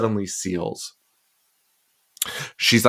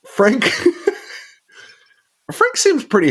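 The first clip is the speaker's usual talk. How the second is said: laughing; 14 semitones higher than usual. Real, not acted. Laugh heard.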